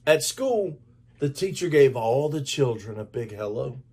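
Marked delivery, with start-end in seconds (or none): none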